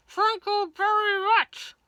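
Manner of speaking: high, loud, slow words forced through clenched teeth. A narrator's reading. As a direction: squeeze it out past a locked jaw. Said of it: "through his clenched teeth".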